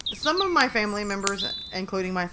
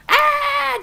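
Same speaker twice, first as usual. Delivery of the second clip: high, loud mock scream; Bear Bear; 8 dB louder than usual. Pet reacting to the household zoo, loud mock scream. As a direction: let out a sudden high yell. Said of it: "And then Bear Bear's like".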